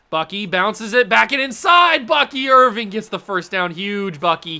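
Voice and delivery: cheering the run as it happens; shouting the big gain; excited shout. Real, not acted.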